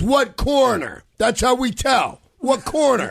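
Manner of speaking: mock caller voice; imitating caller. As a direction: mimic the angry caller slowly and high. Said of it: "And he goes".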